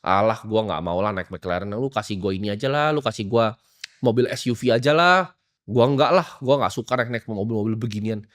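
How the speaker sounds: mock arrogant refusal. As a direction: brush off the gift like it's beneath him. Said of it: "orang yang arogan ini akan apa".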